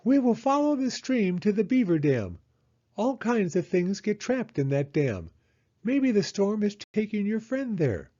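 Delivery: high, confident owl; Owl's voice. Acted. Owl explaining the beaver dam plan, high and confident.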